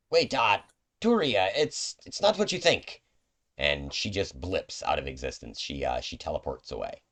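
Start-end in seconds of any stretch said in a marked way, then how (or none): 0.1-3.0 pleading, pitched up high and louder; urgent calling after her. Acted. Companion chasing the guard, pleading urgently. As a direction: call after the storming guard, desperate to explain. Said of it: "begins to chase after her and says".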